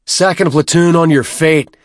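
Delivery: loud high parade-ground bellow. A narrator's reading. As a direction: bellow the order to the platoon. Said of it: "Byrne bellowed".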